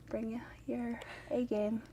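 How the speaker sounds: whispered challenge, no voice. Acted. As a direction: breathe it as a dare.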